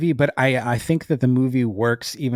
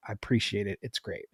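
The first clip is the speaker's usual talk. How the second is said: ordinary talk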